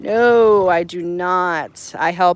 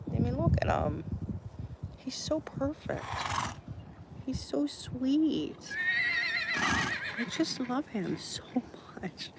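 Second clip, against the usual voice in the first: high, soft doting coo; 8 semitones higher, 9 dB quieter than usual. Real, not acted. Owner doting on her favorite horse, soft high coo. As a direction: melt over him softly.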